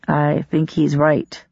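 slow, measured words. A narrator's reading. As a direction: say it slowly and thoughtfully. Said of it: "Namir said slowly".

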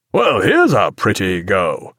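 slow, cheerful captain's voice; breezy, unhurried character voice. A narrator's reading. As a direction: draw it out in hearty mock dismay. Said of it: "He sounded quite cheerful".

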